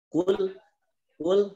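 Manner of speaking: "Kul" held and lifted high, the alif after it dropped; stressed kul. Shown as a demonstration. Ustaz showing the students how kul joins, stressed high. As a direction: pitch "kul" up, then link straight on. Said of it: "a-nya tidak dibaca".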